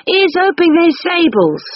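high called-out shout; shouting after. Acted. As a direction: call it down the street. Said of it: "he shouted after us".

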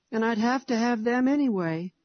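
put-on a small boy complaining he must have them; tearful little boy. A narrator's reading. Narrator voices little Harry.

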